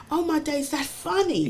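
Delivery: high-pitched imitation of amused disbelief; amused onlooker. Comedian watching her own sketch, amused. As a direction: mimic yourself as a tickled viewer. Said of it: "standing outside of myself laughing".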